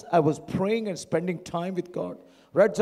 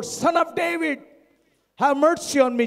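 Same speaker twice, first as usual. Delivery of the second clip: high, insistent cry read from scripture; raised shout; 9 semitones higher, 6 dB louder than usual. A narrator's reading. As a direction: cry out higher, pleading over the crowd. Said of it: "he shouted all the more".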